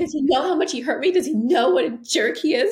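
high, pleading whine of a hurt partner; mock the old manipulative crying voice, pitched high; imitating needy self-pity. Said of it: "there was this little, like".

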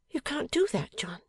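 high-pitched surprised echo; Bella, incredulous wife. A narrator's reading. Wife questions her husband's refusal, incredulous. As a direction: throw his refusal back, pitched far up.

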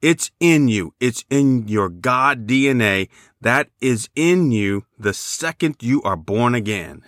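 ordinary talk

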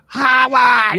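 high shrill nagging mom shout; Howard's mom voice. Retold or imitated. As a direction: bellow for your son. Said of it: "mom from the Big Bang Theory".

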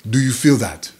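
measured imitation of an African accent; lightly put-on African accent, calm question. African inmate to beaten chess partner, African accent. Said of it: "he talked like this".

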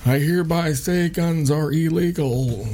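slow, high, pompous presidential voice; mock Biden decree. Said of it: "he's just gonna".

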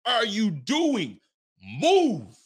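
high, loud, drawn-out shout; yelling at the TV. Retold or imitated. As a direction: yell at the screen like the play just ruined the game. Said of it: "I'm yelling at the T V screen".

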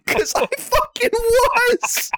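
high, slow words squeezed through laughter; laughing. Real, not acted. Laughing at his own embarrassment.

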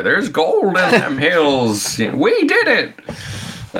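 put-on slow, high, cackling old gold miner; old prospector voice. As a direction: ham up a giddy old prospector. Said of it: "like the old prospector".

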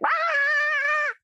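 frantic cry. Retold or imitated. Anxious clients on the phone, mock panicked shriek. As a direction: squeal out an overwhelmed client's panic. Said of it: "clients who get on a phone call with me and are like".

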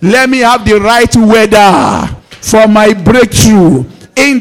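loud, rhythmic prayer leading; preacher calling out blessings over the congregation; fervent prayer. Acted. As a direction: lead the church in declaring prayer.

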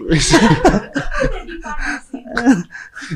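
laugh. Real, not acted. Laughs at guest's joke.

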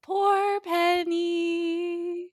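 high, very drawn-out lament; sing-song poor-thing commiseration; mock pity. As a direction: stretch it out in exaggerated sympathy.

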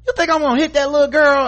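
high, loud, fast indignant yell; mock furious shout. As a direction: scream it down at the girl. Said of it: "she was like".

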